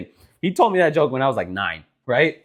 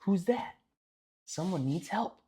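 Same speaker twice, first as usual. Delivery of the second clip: a touch higher, puzzled little voice; lightly voiced mouse; 6 semitones higher, 13 dB quieter than usual. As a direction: perk up like a small curious creature. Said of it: "And he's like".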